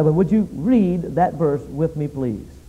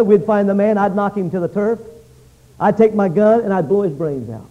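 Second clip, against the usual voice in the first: usual speaking voice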